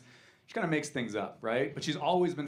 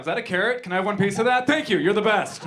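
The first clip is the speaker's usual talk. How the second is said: high, loud begging that bursts into thanks; pitched up, gushing and over-keen; eager dog voice; 6 semitones higher, 7 dB louder than usual. Acted. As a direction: play an excitable dog after a treat. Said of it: "This is my dog".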